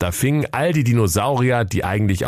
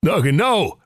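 mild mock roar; 9 semitones higher than usual. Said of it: "brüllte der Jakob Grimm wütend".

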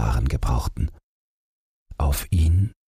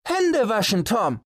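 high calling voices across the garden. Acted. Family calling the hidden boy to lunch, high sung-out calls. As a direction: sing out the name high and long. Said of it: "riefen sie".

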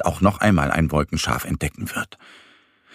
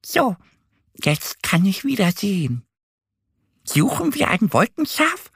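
much higher, bright duckling voice; cheerful duck Annie; 13 semitones higher than usual. A narrator's reading. Annie, cheered up, asks Mo to search, happy. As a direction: ask happily, ready to play again. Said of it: "fragt sie".

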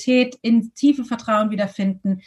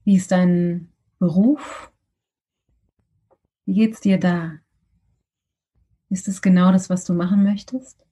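guided meditation; 3 semitones lower than usual. Acted.

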